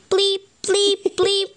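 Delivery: high electronic bleep sounds; computer bleeps. Acted. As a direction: bleep like a game console. Computer noise in story.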